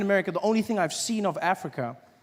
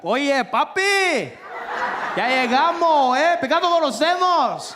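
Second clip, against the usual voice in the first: excited Spanish speaker; 8 semitones higher, 5 dB louder, slower than usual. Retold or imitated. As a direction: hail a countryman excitedly in Spanish. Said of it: "some guy came up to me and he was like".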